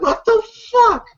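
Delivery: high-pitched disbelief; incredulous reaction. Retold or imitated. Viewer reacting to an absurd chase, high incredulous outburst. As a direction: blurt it out high, baffled.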